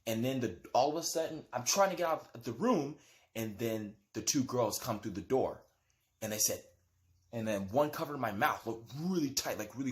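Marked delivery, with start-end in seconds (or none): none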